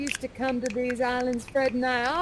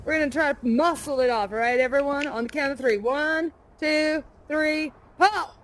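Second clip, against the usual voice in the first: ordinary delivery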